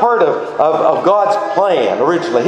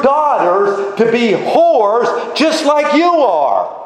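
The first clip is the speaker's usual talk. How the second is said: stern rebuke; 7 semitones higher than usual. Retold or imitated. God rebukes the people.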